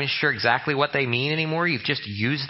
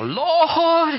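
drawn-out, much higher and louder "Lord God Jesus"; bold prayer voice; 12 semitones higher, 5 dB louder, much slower than usual. Retold or imitated. Pastor mocking showy prayers, a hammed-up bold invocation. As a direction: boom out the Lord's name like a grand proclamation. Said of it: "even say it with the".

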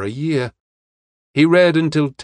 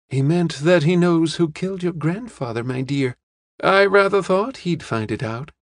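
put-on higher-pitched old lady explaining; old lady, knowing; 6 semitones higher than usual. Old lady enlightens the young woman, high and knowing.

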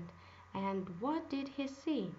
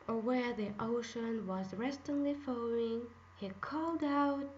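ordinary delivery, nothing marked

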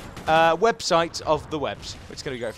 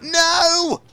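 high, loud anguished no; shouting at computer; 12 semitones higher, 7 dB louder than usual. Real, not acted. Annoyed gamer to the computer, high shouting. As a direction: let out a high yell of frustration. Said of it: "hence why I'm shouting at the computer".

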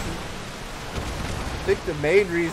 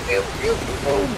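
ordinary talk

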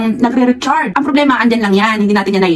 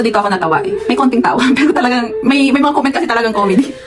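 normal talking voice

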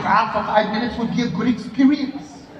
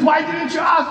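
mock shout; 6 semitones higher than usual. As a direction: yell it at him in disbelief. Brother scolding in retold story.

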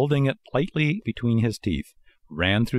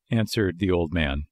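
usual speaking voice

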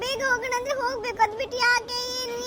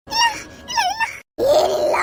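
1 frightened shout; 8 semitones higher, 4 dB louder than usual. Acted. Desperate denial to ghost.

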